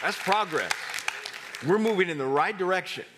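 loud, high joyful exclamation; excited shout. Real, not acted. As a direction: shout it out with delight. Preacher exclaims with joy.

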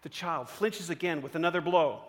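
ordinary talk, nothing marked

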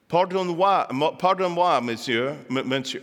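hesitant stab at French pronunciation; attempted French. Speaker attempting Marie Antoinette's last words, tentative French. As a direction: try the French, stumbling over it. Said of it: "let me see if I've got the French right".

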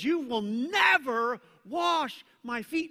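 loud, high, slow emphatic never; firm refusal. Retold or imitated. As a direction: say it with full conviction. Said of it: "but he says".

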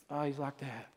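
hushed, low, wistful wife; acted savoring. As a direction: say it softly to yourself, savoring the scent. Imagining wife savoring husband's scent.

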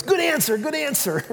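high, cheering game-show chant; mock game-show crowd cheering; Family Feud crowd. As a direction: cheer it like the studio audience. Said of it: "on Family Feud, when everybody goes".

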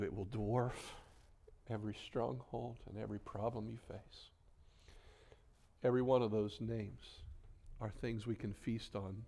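soft, hushed words with long pauses; quiet awe, unhurried; reverent reflection. Real, not acted. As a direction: let each phrase settle over the congregation. Sermon turns hushed and reverent.

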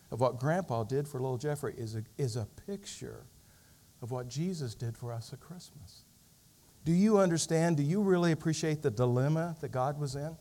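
usual speaking voice

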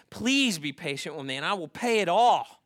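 loud, mildly pleading narration; a touch of desperate appeal; begging. A narrator's reading. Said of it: "fell down before his master and begged".

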